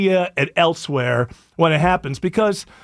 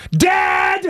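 high-pitched, drawn-out slam poetry outburst; mock a slam poet's big dramatic delivery; 7 semitones higher, slower than usual. Host mocks slam poetry for the co-hosts, overwrought slam poet. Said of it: "slam poetry's more like".

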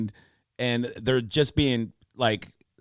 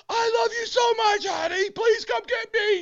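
very high falsetto wail; mock screaming. Arrested driver begging his partner for rescue, mock scream. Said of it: "And the guy's just screaming".

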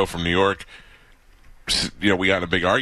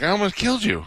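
shocked exclaim; 14 semitones higher than usual. Retold or imitated. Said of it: "I was like".

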